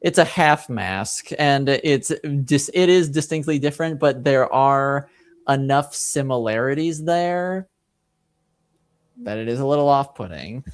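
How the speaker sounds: ordinary talk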